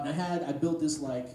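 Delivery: ordinary talk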